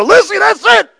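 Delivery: high excited shout; father yelling to his daughter. Retold or imitated. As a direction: call out to Lizzie thrilled. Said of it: "I'm yelling to my daughter".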